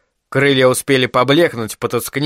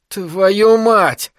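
higher-pitched, exasperated swearing; spat-out curse; 6 semitones higher than usual. A narrator's reading. Isaac curses as the militia hold position, spitting it out. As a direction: spit out the curse. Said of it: "сплюнул Айзек".

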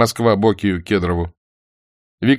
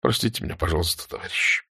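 soft, halting request for forgiveness; sighing apology; 2 semitones lower, 7 dB quieter than usual. A narrator's reading. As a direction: apologise quietly, voice sinking. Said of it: "посмотрел на Рыжего и вздохнул". (тихо, виновато)

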